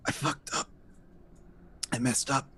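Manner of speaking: weak, halting confession, barely voiced; short phrases with long gaps, fading strength; wounded man. Dying man admits his mistake to rescuers, weakly. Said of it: "He's like".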